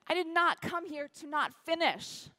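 loud, high, defiant declaration; re-enacted outraged determination; indignant protest. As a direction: refuse to accept the cutoff. Outraged at the cutoff.

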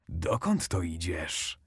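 a big bad wolf sizing up his prey; sly wolf. A narrator's reading. Wolf asks girl in forest. (niski, cichy, powolny głos wilka)

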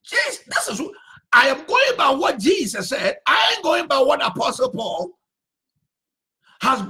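fiery preacher's rebuttal; preaching shout. Acted. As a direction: demand an answer, then fire back.

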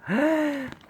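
high, sharp gasp of delight; surprised gasp. Pretend. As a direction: gasp as if amazed at the toy. Dad finds toy truck, gasps.

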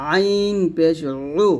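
আইন পেশ উ with উ squeezed in mid-throat; pressed ayin u. Shown as a demonstration. Teacher drilling the vowel for learners, pressed ayin. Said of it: "চাপ দিয়ে".

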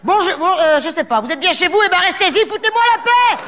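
mock rude shout.

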